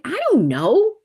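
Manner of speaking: high, loud helpless cry. Retold or imitated. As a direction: cry it out, stumped. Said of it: "I'm like".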